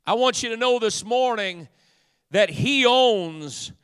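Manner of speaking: high, loud declaiming with dramatic pauses; rolling pulpit oratory, item after item; full-force preaching. Real, not acted. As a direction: proclaim scripture to the whole congregation.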